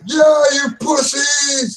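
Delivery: high, drawn-out jeering yell; triumphant taunt at the top of the range; taunting shout. Acted. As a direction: crow it after a fleeing enemy. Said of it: "and he's shouting".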